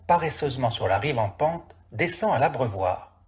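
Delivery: ordinary delivery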